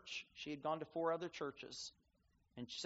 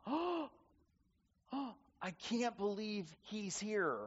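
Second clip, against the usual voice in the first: high gasping "Oh", then a slow, stunned "I can't believe he's here"; imitating a girl aghast at the door; 4 semitones higher, slower than usual. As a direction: retell her shocked exclamation, stretching CAN'T.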